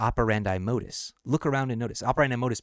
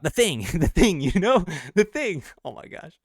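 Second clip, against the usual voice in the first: laughing excitement; 8 semitones higher, 5 dB louder than usual. Real, not acted. Excited recall of a famous banner.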